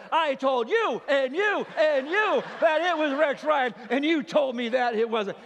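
high, loud scolding, hammed up; loud rebuke. Old man rebuking his doubting children at the table, loud imitated scolding. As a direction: berate each one in turn, imitating vindicated indignation. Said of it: "he starts rebuking his family".

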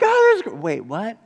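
very high start, cut off and dropping; sudden double take; stunned mid-sentence; surprised blind man. Retold or imitated.

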